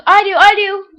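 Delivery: high, loud schoolboy shout; eager yell. A narrator's reading. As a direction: yell from the back of the classroom. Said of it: "Johnny from the back of the room yells".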